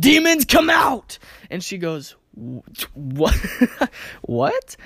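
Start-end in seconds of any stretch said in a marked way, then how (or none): 0.0-1.2 loud, high, commanding exorcism cry; mock exorcist shout. Speaker retelling a prank on crying friend, mock exorcist shout. 2.4-4.8 imitated laughing disbelief. Speaker imitating friend thrown by the prank, laughing disbelief. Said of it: "she begins to laugh hysterically".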